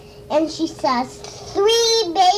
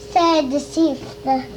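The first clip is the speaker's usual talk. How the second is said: ordinary delivery, nothing marked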